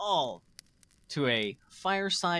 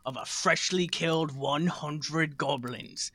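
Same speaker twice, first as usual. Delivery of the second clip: epic tale telling. Acted. Host opening the show with a mock battle tale, dramatic. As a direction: spin a dramatic barbarian war story.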